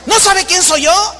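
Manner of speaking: high, loudish mock outrage; haughty indignation. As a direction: imitate a celebrity demanding recognition. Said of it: "Habla de altanería".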